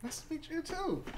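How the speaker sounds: high, enthusiastic tiefling; bubbly, high-pitched character hello; eager greeting. Acted. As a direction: greet a new acquaintance very eagerly. Tiefling greets, shakes hand enthusiastically.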